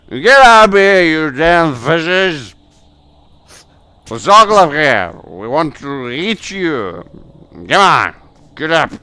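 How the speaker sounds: high, full-force yell at the fishes; angry shouting. Acted. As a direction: holler threats down an ice hole. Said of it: "keeps shouting there".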